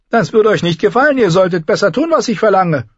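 high, loud cruel king; cruel boy-king. A narrator's reading. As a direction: threaten her with a child's glee. Joffrey threatens Sansa. (hoher, lauter grausamer Knabenkönig)

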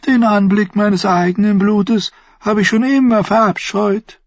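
Varys gasps high and startled; gasped. Acted. Varys' hohe Stimme. (gekeucht, hoch und erschrocken)

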